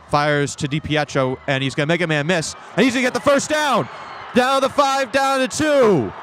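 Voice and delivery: rising excitement to a yelled call; excited play call. Real, not acted. Big play call, rising to a shout.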